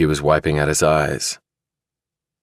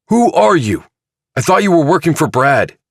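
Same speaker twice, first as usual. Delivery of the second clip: much higher and louder demand; furious shout; 12 semitones higher, 5 dB louder than usual. Acted. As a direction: whirl round and yell it. Chilton whirls round on Divine.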